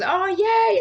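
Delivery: high, loud celebratory whoop; group cheer. Retold or imitated. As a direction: imitate a delighted class cheering you. Acts out class cheering her breakthrough.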